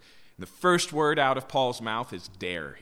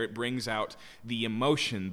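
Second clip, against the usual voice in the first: ordinary talk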